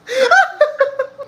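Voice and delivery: a real, high cackle of delight; high shrieking laugh. Real, not acted. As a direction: burst into a squealing laugh. Laughs at the messy story.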